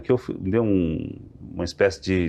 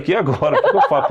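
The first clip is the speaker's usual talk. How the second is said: laughing panic; 6 semitones higher, 5 dB louder than usual. Retold or imitated.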